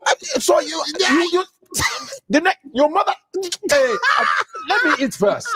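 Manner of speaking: high, broken-off Nigerian dad outburst; sputtering father. Retold or imitated. Father too angry to finish sentences, sputtering. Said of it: "nothing but commas".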